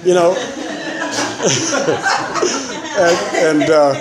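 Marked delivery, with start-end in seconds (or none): 0.4-2.9 high, airy laugh; a long, silent wheeze of laughter; real laugh. Real, not acted. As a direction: laugh at the memory. Laughs at himself in sweater.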